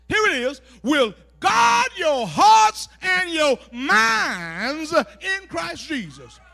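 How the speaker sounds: loud, slow, drawn-out preacher's reading; proclaiming scripture. Acted. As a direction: stretch the big words at full force.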